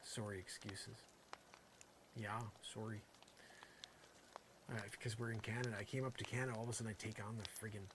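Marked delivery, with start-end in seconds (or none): 0.0-3.0 soft, low Canadian "sore-ee"; Canadian "sorry". Shown as a demonstration. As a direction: lean lightly into the Canadian vowel on "sorry". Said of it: "I did a Canadian sorry there".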